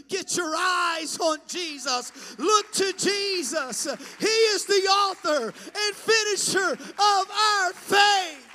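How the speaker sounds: high, loud, rising preacher's cry; crying out the message to the congregation, loud and high; preaching shout. Real, not acted. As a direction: proclaim it at the top of your voice. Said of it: "I just want to be that messenger today to say".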